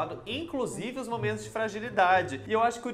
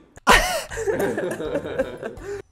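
loud, high laughing outburst; high burst of laughter; 9 dB louder than usual. Real, not acted. Host laughing at the Carlinhos Maia crying video, loud high laughter.

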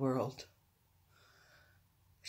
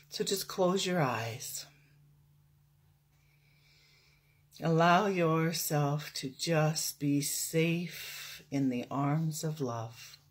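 guided meditation; 3 dB quieter than usual. Real, not acted. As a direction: guide them into stillness, unhurried.

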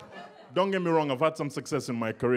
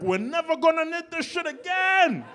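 high, loud gleeful cheer; mock Canadians cheering the end of winter; hammed-up glee at ditching winter gear; mock celebration; 5 dB louder than usual.

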